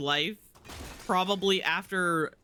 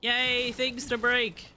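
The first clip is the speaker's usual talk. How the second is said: high, bright little yay; excited cheer; 8 semitones higher than usual. Real, not acted. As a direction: cheer at the chance to smash. Cheering at breakable objects.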